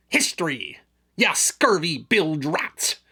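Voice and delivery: slow growling pirate. Acted. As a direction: drag it out like a salty buccaneer. Back into pirate character.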